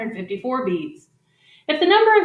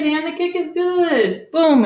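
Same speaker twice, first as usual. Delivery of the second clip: mock goal call; 7 semitones higher, slower than usual. Kyle kicks again, goal call.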